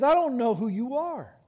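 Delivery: high, loud preacher voicing Jesus' verdict; impassioned pulpit rebuke; forceful rejection. Preacher voices Jesus rejecting claimants.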